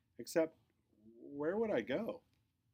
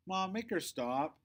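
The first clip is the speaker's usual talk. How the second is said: higher, slow, desperate appeal to Mom; pleading cry; 8 semitones higher, 3 dB louder, slower than usual. Acted. Quinny begging Mom while Cleo scribbles, high slow plea. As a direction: whine for help as the marker ruins it. Sister scribbling on her backpack.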